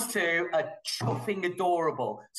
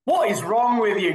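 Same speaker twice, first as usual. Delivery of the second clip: loud exasperated what is wrong; playful exasperated shout; mock outrage; 4 semitones higher, 8 dB louder than usual. Real, not acted. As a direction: shout it in disbelief.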